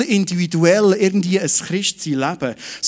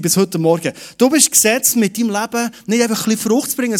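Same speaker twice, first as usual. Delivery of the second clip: normal talking voice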